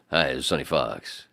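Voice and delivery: low radio DJ greeting; Sonny Fox. Retold or imitated. Imitating a radio host he heard every morning, DJ impression. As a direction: do a low-voiced morning DJ intro. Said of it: "We listened to fucking Magic every day".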